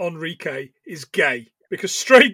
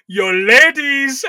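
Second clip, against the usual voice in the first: mock Latin lover; 6 semitones higher, 8 dB louder, much slower than usual. Imitating Raul advert catchphrase.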